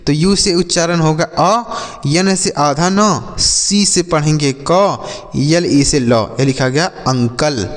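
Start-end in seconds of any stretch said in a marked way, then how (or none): none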